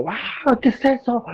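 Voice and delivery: high, shocked yelp of fright; startled cry. Acted. Disciples shouting in fear at the figure on the water. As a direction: gasp it out, scared of what they see. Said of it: "O sea, era como".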